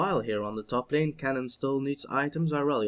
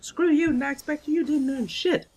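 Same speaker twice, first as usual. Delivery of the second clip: much higher, mocking put-on voice; mock impersonation; 14 semitones higher, 3 dB louder than usual. Caster mocking a teammate for the water break in a much higher voice. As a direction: squeak out a cheeky jab at a friend. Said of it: "I don't know who I try to impersonate there".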